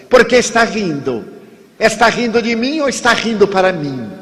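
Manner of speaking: high, challenging stranger's voice; irritated stranger. Retold or imitated. Stranger in the queue confronting him, high and pointed. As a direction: needle him like a rude stranger.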